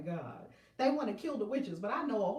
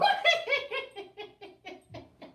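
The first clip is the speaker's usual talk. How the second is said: high laugh fading out; 6 dB louder than usual. Real, not acted. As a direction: giggle it away. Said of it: "blame it on the full moon".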